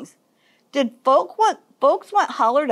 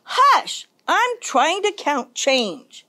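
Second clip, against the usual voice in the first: called-out hush; 5 semitones higher, slower than usual. A narrator's reading. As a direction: shush them as a busy ticket seller. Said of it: "The ticket seller called".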